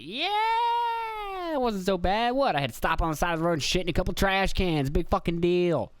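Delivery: brushing it off in a silly high voice; mock carefree self. Paycheck day self brushing off a rough week, silly high voice. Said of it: "be like".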